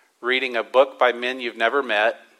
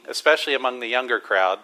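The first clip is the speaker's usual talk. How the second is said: ordinary delivery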